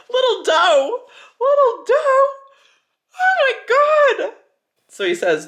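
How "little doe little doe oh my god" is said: much higher and louder, shrieking; excited squeal. Real, not acted. Reader gushing over the villain's pet name, high excited shriek.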